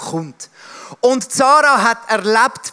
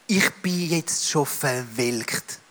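wilted and weary; 7 semitones lower, 7 dB quieter, much slower than usual. Acted. Preacher voices Sarah's bitter words.

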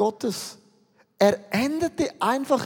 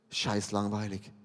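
low, much softer, glum and bored; 11 semitones lower, 11 dB quieter than usual. Pretend. Preacher voices how listeners feel about everyday life, glum and soft. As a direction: sink the word like a weary sigh. Congregation's dull everyday named.

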